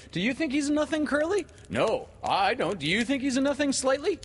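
put-on very high, loud boys' voices; eager cry. A narrator's reading. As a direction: call it round high and loud. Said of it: "Toodles cried instantly".

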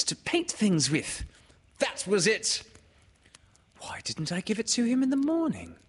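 high, animated, searching for the memory; high-pitched, eager recollection; reassuring storyteller. A narrator's reading. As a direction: reassure a child with growing enthusiasm.

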